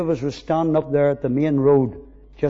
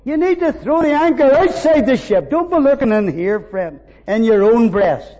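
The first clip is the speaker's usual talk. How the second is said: loud, high, rising preacher's cry; fervent preaching; 9 semitones higher, 8 dB louder than usual. Acted. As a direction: lift the room with swelling praise. Preaching at full force.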